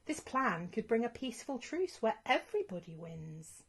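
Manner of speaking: plain reading voice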